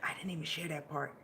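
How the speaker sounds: quick soft whisper. Real, not acted. Confiding in the stream, quick whisper. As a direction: say it under your breath.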